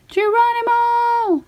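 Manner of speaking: high, stretched yell of Geronimo; gleeful shout. Acted. Mac diving back into the watering hole, a high gleeful yell. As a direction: whoop it as you leap.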